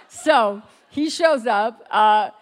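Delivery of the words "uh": ordinary talk, nothing marked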